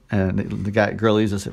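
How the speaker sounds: normal talking voice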